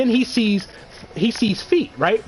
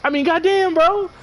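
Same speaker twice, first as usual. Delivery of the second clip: much higher pitched, voice cracking up into laughter; losing it as the laugh takes over; squeaky high giggling exclamations; laughing disbelief; 11 semitones higher, slower than usual. Real, not acted. Cracking up at his own idea.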